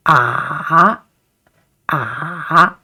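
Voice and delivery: a long curved "ah", down and back up; third tone. Shown as a demonstration. Teacher demonstrating the curved third tone, dipping "ah". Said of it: "down and then uphill".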